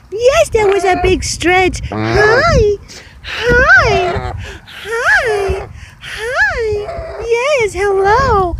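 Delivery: high, bright sing-song voice for the bird; pet talk. Acted. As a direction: coo at the bird like a baby. Greeting the bird.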